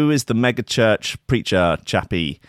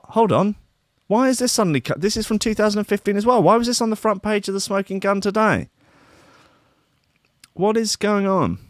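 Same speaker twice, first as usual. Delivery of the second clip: high-pitched, incredulous questioning; baffled exasperation; 10 semitones higher than usual. Real, not acted. Host finding old news on today's front page, baffled. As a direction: protest in a high, bewildered voice.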